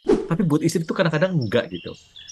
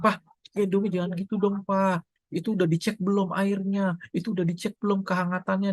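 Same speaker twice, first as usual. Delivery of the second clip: higher-pitched mock nagging wife; 7 semitones higher than usual. Wife nagging her husband over bathing the baby, mock scolding. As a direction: nag him about the bath water, pitched up. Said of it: "udah dimarahin duluan".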